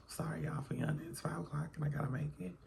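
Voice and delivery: whispered apology, fast near the end; real whisper. Real, not acted. Recording at five in the morning, whispering to viewers. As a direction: whisper so nobody at home wakes. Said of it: "five o'clock in the morning".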